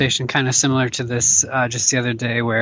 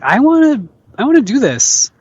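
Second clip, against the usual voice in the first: excited; 10 semitones higher, 4 dB louder than usual. Retold or imitated. Teen self voiced eagerly.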